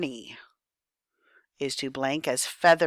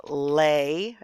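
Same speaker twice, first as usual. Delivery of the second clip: slow syllable demo; 4 dB louder than usual. Shown as a demonstration. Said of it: "this is called an open syllable".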